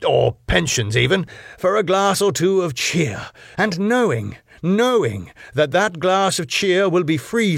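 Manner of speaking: high, swooping exasperated lecture; long-suffering. A narrator's reading. Boss scolds barman over his smoking, long-suffering. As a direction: pile up the reproach with weary patience. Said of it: "Max, long-sufferingly".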